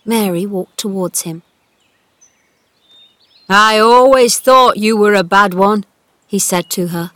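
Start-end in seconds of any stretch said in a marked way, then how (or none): 3.5-5.8 loud, gruffly cross telling-off; cross scolding. A narrator's reading. Old gardener scolding Mary over the wall, cross. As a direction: scold her loudly. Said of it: "shaking his fist".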